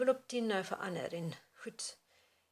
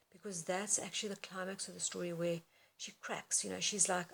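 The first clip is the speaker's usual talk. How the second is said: usual speaking voice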